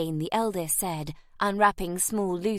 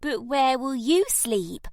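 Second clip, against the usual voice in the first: gruff little voice; 7 semitones higher, 3 dB louder, slower than usual. A narrator's reading. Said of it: "in a gruff little voice".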